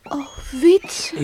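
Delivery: crying whisper; tearful whisper. Acted. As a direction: whisper his name close to tears. Hurt by his deceit. (huilerig gefluisterd)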